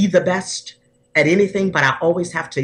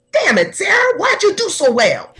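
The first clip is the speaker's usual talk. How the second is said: far higher, teary mock scolding of herself; hammed-up self-reproach for doing too well; 14 semitones higher than usual. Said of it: "then I'm not saying".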